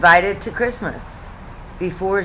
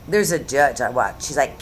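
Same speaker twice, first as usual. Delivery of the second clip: normal talking voice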